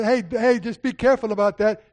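loud, high preaching voice. Acted. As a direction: preach it out with full force. Preaching at full force.

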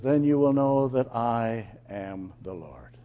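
low, measured reading of God's words; solemn scripture reading. Acted. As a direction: read it gravely, slowing on the last line. Corrects with the actual verse.

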